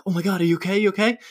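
high, alarmed mock of a woken wife; startled worried wife. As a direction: squeak it out like she's panicking.

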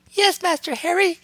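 very high eager elf voice; squeaky house elf. A narrator's reading. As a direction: squeak like an excitable little elf.

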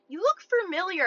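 high mocking imitation of a come-on; put-on chat-up voice; mock club guy. Mimics guys' opening line.

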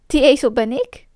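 ordinary delivery, nothing marked

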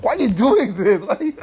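loud slowed yell; mock angry yell. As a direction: yell it at the friend. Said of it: "I was like".

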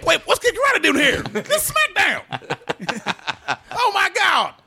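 high frantic ringside yell; wrestling commentator. Pretend. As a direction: scream it like the match just broke. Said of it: "went into the wrestling voice".

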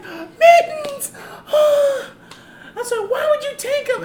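high, loud mock wail with a gasp; mock crying girl. Girl getting her stolen cat back, high hammed-up wail. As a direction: wail the cat's name like a heartbroken kid. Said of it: "I was like".

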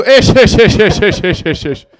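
high clipped ish-ish mock laughter; mock German laugh. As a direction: do a silly foreign-audience laugh. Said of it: "they laugh differently too".

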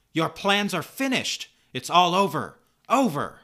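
high, loud cry of defiance; triumphant furious shout. Acted. Supergirl pummels the villain, shouting in fierce defiance. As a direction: shout it at the enemy like a final blow.

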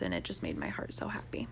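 soft breathy whisper; almost whisper it, tender. Real, not acted.

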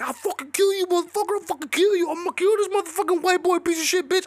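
high, squeaky mock rage; furious Floyd impression. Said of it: "I've never seen Floyd so fucking mad. He was like".

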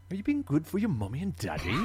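high, exaggerated wrong accent for Santa; botched Santa accent. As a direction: imitate a terrible Santa accent, pitched high. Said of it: "several different accents".

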